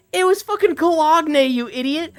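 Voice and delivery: high, loud imitated snapping back; hammed-up teammate yelling back in frustration; mock angry reply. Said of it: "Corey's just like".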